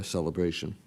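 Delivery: ordinary talk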